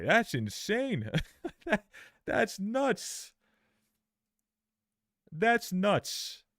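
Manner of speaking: high-pitched incredulous reaction; real disbelief. Real, not acted. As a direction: exclaim in stunned, half-laughing disbelief.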